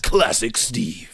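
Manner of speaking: very slow epic tagline; movie trailer announcer. Pretend. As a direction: deliver it like a tagline. Trailer voice bit.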